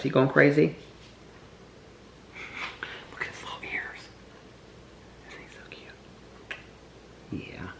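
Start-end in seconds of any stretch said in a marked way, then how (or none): 3.1-7.7 soft unvoiced whisper; hushed whisper, slow and breathy. Real, not acted. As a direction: whisper so the puppies stay calm. Whispering near sleepy puppies.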